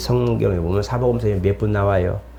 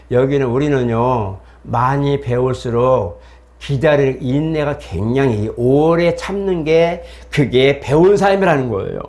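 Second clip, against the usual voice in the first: patient local explanation; slower than usual. Retold or imitated. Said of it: "자매한테 물어봤어요".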